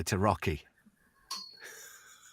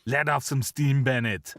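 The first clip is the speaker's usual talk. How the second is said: lightly Austrian Arnie line, words in right order; deadpan Arnie delivery; Arnie quote, corrected; 4 semitones higher, 4 dB louder than usual. Retold or imitated. Host correcting the Commando quote, Arnie deadpan. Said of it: "Actually, the line is".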